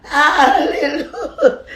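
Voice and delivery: slow, high, loud hallelujah through a laugh. Real, not acted. Still praising over dinner, a laughing sung hallelujah. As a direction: stretch it out laughing.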